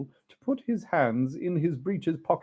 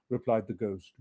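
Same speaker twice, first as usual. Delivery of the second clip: ordinary delivery, nothing marked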